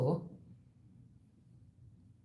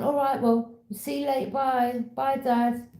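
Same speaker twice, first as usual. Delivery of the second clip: quoted goodbye; 8 semitones higher, 4 dB louder than usual. Retold or imitated. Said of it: "they've gone".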